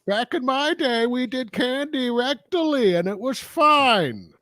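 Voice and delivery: high, put-on old granny voice; grandma impression. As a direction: reminisce like a cranky grandmother. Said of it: "My grandmother, half deaf".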